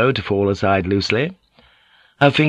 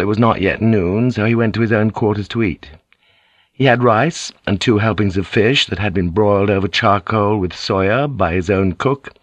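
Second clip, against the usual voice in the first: ordinary delivery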